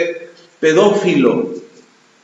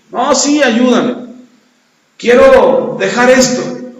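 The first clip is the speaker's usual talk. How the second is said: higher, slow pleading; higher, drawn-out plea; pleading drunk; 6 semitones higher, 3 dB louder, slower than usual. Retold or imitated. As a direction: beg slowly to be freed.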